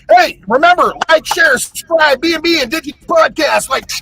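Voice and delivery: loud, high, hyped call to like and subscribe; hyped outro shout. Acted. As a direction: pump up the listeners. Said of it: "podcast outro call to subscribe".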